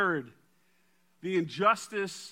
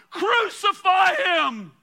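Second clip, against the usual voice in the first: high, slow roaring cry of the mob; a mob's shout for execution; crowd shouting; 12 semitones higher, 11 dB louder, slower than usual. Acted. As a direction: bellow it out like a furious crowd. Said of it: "And they cried out".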